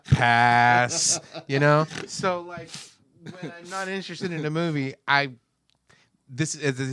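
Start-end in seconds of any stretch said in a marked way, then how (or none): 0.0-1.2 dismissive pass. Retold or imitated. Dismissing a trailer, laughing.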